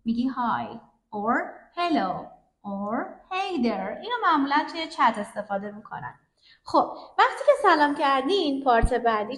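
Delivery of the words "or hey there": "hey there" drawn out slowly, each word clear; slow demo. Shown as a demonstration. Teacher slowing "hey there" down for the class.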